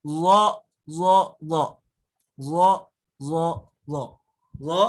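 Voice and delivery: lo with a thick emphatic dzo; heavy dzo sound. Shown as a demonstration. Teacher contrasting the letter dzo with dad. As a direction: keep the tongue tip on the teeth, heavy. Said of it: "Beda lagi dengan huruf".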